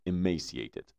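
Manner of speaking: usual speaking voice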